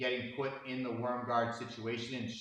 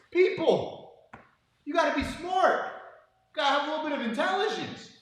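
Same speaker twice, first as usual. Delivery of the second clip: much higher, louder, exasperated rant; exasperated yelling; 6 dB louder than usual. Retold or imitated. Coach yelling at students holding the grip, exasperated shout. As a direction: yell at the whole class in despair. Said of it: "And I'm like".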